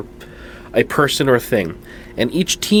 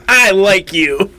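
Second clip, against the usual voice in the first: fond; 10 semitones higher, 6 dB louder, slower than usual. Retold or imitated. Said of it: "and I'm like".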